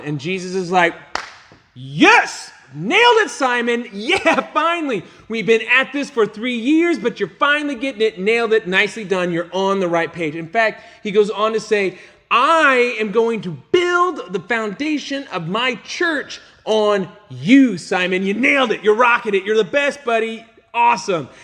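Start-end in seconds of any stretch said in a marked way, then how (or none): 1.7-10.4 high, loud, gushing cheer; over-the-top delighted congratulations; thrilled praise. Retold or imitated. Jesus praising Simon, excited. 12.3-21.3 high, loud, gushing cheer; over-the-top delighted congratulations; thrilled praise. Retold or imitated. Jesus keeps praising Simon.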